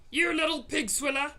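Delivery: loud, high giant insult; furious roar. A narrator's reading. Blood bottler raging at the BFG. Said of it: "roared the blood bottler".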